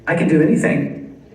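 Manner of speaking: ordinary delivery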